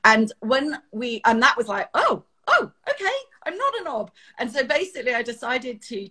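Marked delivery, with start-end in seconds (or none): 1.9-4.1 relieved surprise. Retold or imitated. Relief after teacher's praise.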